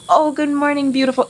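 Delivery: lightly voiced admirer; mock sweet catcall. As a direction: imitate a harmless flirt. Example of mild catcall.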